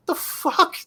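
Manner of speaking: high, stunned mock disbelief; incredulous. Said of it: "You're like".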